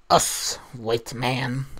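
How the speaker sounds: slow, lightly put-on Tonto voice; Tonto-style voice. Fergus in a Tonto mask teasing Sam, slow mild put-on voice. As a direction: drag it out in a mild Tonto act. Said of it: "Tonto mask over his head and said".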